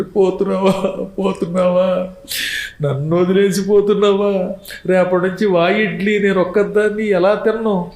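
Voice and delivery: a hint of a widow's plaint; mock grieving wife. Wife laments over her dying husband, mock wailing. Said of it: "అలా తలుచుకుని ఏడుస్తారు".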